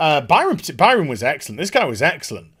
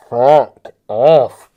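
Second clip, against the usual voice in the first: angry snap; 6 semitones lower, 8 dB louder than usual. Real, not acted. Angry rant at actor.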